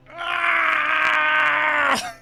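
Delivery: high, loud frustrated cry; inner scream. Retold or imitated. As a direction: let out a shrill wail of despair. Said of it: "But internally, I'm just like".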